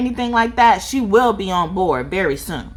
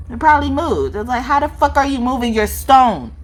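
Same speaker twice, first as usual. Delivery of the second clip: ordinary delivery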